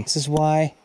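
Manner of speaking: usual speaking voice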